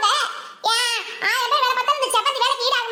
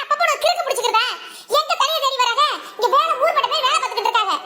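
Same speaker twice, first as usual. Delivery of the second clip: angry. Acted. Daughter defending him against her mother, angry. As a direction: flare up and argue back. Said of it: "உனக்கு கோவம் வந்துச்சு".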